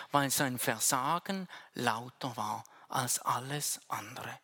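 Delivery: ordinary talk, nothing marked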